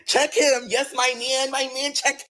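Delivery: high-pitched, delighted hype; gleeful cheering. Retold or imitated. Girlfriend cheering her man confronting a customer, gleeful. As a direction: egg him on in a high voice.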